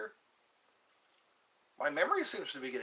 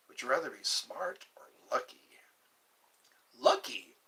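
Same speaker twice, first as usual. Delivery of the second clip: hushed whispered question; secretive whisper to the chat. Acted. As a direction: lean in and whisper it.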